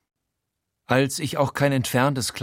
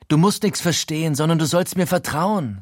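higher, pushy voice; Frankie insisting; 7 semitones higher, 3 dB louder than usual. A narrator's reading. Frankie pushing his friend to trust him, insistent. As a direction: press him to trust you.

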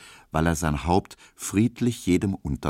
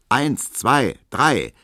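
choir count-in; 5 semitones higher, 4 dB louder, much slower than usual. A narrator's reading. Choir counting in before singing.

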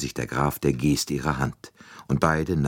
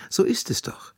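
gentler. Acted. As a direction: ease her into admitting it. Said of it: "sagte Poirot viel freundlicher".

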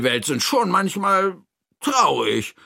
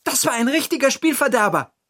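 high, quick, outraged character voice; indignant call; 13 semitones higher, faster than usual. Acted. Hira hears about the thief, high indignant call. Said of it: "ruft sie".

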